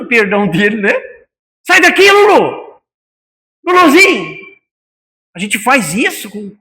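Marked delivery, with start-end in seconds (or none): 1.7-2.8 a demoed angry yell at the dog; angry shout at dog. Shown as a demonstration. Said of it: "repeats the yell as a demo". 3.7-4.4 high, sweet call to the dog; a lightly sweetened call to the dog; tender call. Shown as a demonstration. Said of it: "contrasts the tender call".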